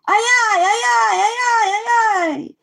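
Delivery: high, loud repeated screech; screamed. A narrator's reading. Glowing eyes startle Jimmy from overhead, screamed. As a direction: shriek it out like a startled night creature. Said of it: "screamed the eyes".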